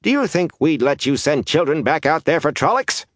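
high, indignant challenge; scornful woman's rebuke; snorted contempt. A narrator's reading. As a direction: throw it back at him scornfully. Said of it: "Days snorted".

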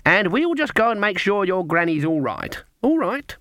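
put-on high, emphatic old granny voice; Granny witch, over-brisk. A narrator's reading. Granny leaving the child with Fairy Tulip, pointedly reassuring. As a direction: lay down the plan, ending high.

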